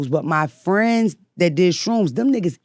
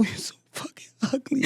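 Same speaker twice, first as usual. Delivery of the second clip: mean mirror insult; 7 semitones higher, 10 dB quieter than usual. Retold or imitated. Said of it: "look in the mirror and go".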